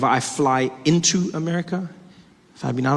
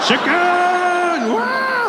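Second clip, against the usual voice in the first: high shout of put-on delight; hammed-up, high-pitched cheering; mock excitement; 13 semitones higher, much slower than usual. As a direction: squeal with exaggerated joy over food. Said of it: "I get really excited for chicken".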